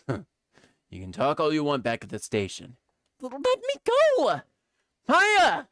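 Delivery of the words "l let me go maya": high, loud struggling yell; desperate shout. Acted. Arrested woman crying out to her friend, high and loud. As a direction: fight them off and cry out. Dragged off by police.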